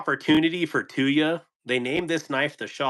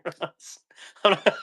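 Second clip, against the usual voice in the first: usual speaking voice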